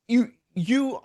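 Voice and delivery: ordinary delivery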